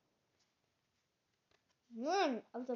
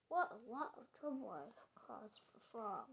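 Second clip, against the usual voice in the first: quiet remorse; 6 dB quieter than usual. Acted. Toad's remorseful thought.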